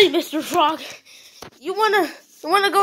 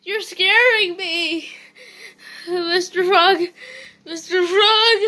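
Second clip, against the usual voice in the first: normal talking voice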